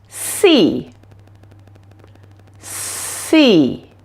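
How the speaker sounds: SEE with a long hissed S, never SH; clear S sound. Shown as a demonstration. Teacher modelling the S sound for learners, long clean S in SEE. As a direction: draw out a crisp S at the start of SEE. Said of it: "Make sure it doesn't sound like she".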